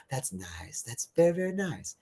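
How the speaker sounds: near-whisper, faux-polite; the poor woman's punchline, whispered sweetly; hushed deadpan. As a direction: breathe it out like a sweet put-down.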